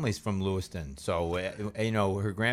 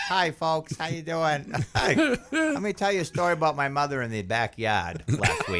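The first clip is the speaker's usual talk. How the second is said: mock graduation speaker; 7 semitones higher than usual.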